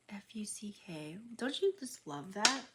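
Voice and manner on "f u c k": whispered letter by letter. Real, not acted. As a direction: spell it out under your breath. Spelling a swear word secretly.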